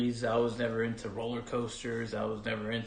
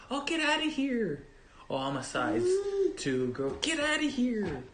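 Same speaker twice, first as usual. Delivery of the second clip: mocking girly voice; 11 semitones higher than usual. Mocking TV law firm women.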